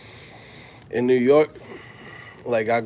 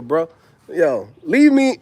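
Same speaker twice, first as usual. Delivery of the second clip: ordinary talk, nothing marked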